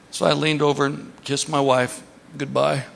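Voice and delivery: usual speaking voice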